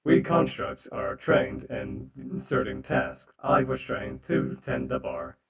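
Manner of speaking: loud, slow robot construct. Acted. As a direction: recite it like a machine. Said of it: "the robot voice".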